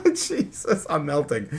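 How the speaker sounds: flustered laughter. Real, not acted. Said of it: "I'm so flustered".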